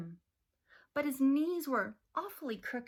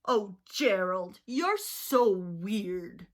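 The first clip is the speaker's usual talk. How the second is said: loud slow mocking jeer; sneering taunt; 7 dB louder, slower than usual. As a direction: drag out the insult, louder. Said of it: "the animals all sneered".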